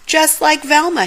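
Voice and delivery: alarmed shout to Scooby; panicked yell. Acted. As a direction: yell it in rising fright. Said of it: "Shaggy yelled".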